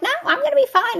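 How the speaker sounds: retold high-pitched brush-off; high dismissive retort. Daughter brushing off mother's warning, high mock protest. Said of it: "And I'm like".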